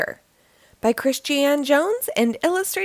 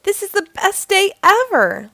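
high-pitched, louder, elated storybook voice; excited declaration; 8 semitones higher, 4 dB louder than usual. A narrator's reading. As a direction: declare it with delighted emphasis. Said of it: "Lacey declared".

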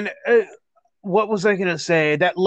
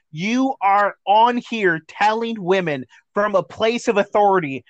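ordinary talk, nothing marked

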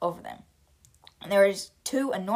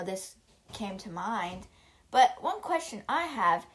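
ordinary delivery

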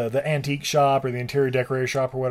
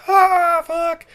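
high burst of pain; mock pained yell; 6 dB louder than usual. Vampires hit by fire bombs, hammed-up yelp. Guys hit by exploding lamps.

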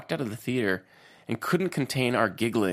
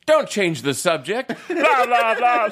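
higher, louder hammed-up angry shout; mock yelling; 11 semitones higher, 5 dB louder, slower than usual. Guy arguing by the woods, mock yelling. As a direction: parody an irate man yelling over someone, breaking into laughter. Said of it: "the guy who does the yelling".

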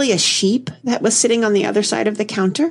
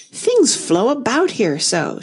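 slow, higher plaintive complaint; 4 semitones higher, slower than usual. A narrator's reading. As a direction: draw it out as a mild lament. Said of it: "in a plaintive tone".

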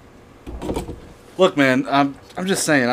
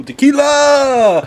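long slow high yell; drawn-out shout; 12 semitones higher, 6 dB louder, much slower than usual. Real, not acted. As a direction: call it out long and loud. Calling for a tequila shot.

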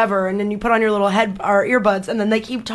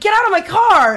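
high, raised-voice command; indignant retold outburst; retold angry order; 10 semitones higher, 3 dB louder than usual. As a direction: throw the rude passenger out, pitched way up. Driver orders screaming passenger out.

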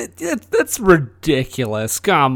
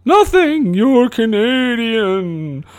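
ghost voice; 7 semitones higher, much slower than usual. Acted. Future [name] answers eerily.

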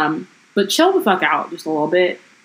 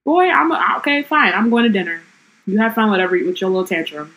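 sassy Ginny. Retold or imitated. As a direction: brush him off like a fed-up teen. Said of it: "She's like".

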